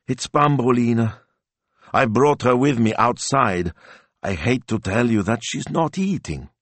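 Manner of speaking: put-on a touch of a pleading old gentleman; worried Signor Rossi. A narrator's reading. Signor Rossi telling the hotel owners his dog won't eat, fretful.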